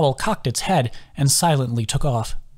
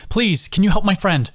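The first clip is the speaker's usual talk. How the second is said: loud begging call, voice pitched up; pleading yell; 8 semitones higher, 3 dB louder than usual. A narrator's reading. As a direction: yell a plea across the clearing. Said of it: "yelling in Tara's direction".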